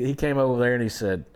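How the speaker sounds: ordinary talk, nothing marked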